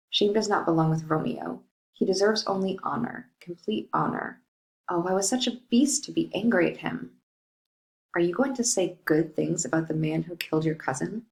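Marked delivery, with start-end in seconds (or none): none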